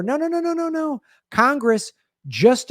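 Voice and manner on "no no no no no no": high, insistent string of no's; emphatic no. Real, not acted. Comic to liberal viewers, a high insistent no. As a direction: cut them off with a rising chain of no's.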